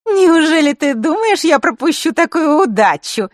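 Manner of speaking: put-on high, loud, snide girl's voice; snide interruption. A girl interrupting the boy, snide and gloating. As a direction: cut him off with a sly gloat. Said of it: "перебила его Маришка, ехидно улыбаясь".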